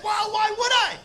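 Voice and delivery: high-pitched, baffled comeback; incredulous protest. Retold or imitated. Comic refusing dude's belly button offer, incredulous protest. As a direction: throw it back, voice leaping up. Acted argument with dancer.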